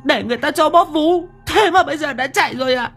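put-on very high, whining, tearful girl's voice; shrill-pitched comic sobbing complaint; wailing jilted girlfriend. A narrator's reading. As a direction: wail after a boyfriend who ran off. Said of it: "Chị chàng dậm chân bành bạch".

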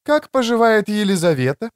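high-pitched voice for a woman; woman's voice. A narrator's reading. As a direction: pitch it up for the female character. Narrator voices the woman character.

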